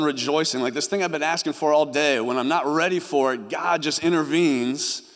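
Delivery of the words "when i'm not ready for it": normal talking voice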